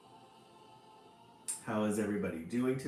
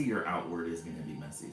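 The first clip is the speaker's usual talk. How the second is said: ordinary talk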